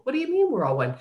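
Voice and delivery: high-pitched, scoffing retold comeback; eye-rolling disbelief. Sister and niece answering "we're all one", mock eye-rolling disbelief. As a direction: mimic a dismissive relative with an eye roll. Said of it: "You get the eye roll".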